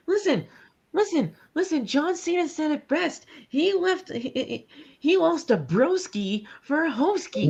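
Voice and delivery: hammed-up solemn lesson; mock preachy. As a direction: deliver it like sacred wisdom. Solemn mock wisdom quote.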